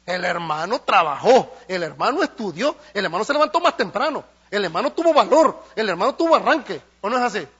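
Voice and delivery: loud, building list of what the brother did; rising pulpit emphasis. Acted. Preacher rebuking the envious, loud and building. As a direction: hammer each line harder.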